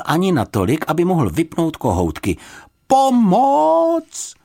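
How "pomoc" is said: high, loud drawn-out cry; high-pitched distress call; calling for help. A narrator's reading. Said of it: "zkusil zavolat".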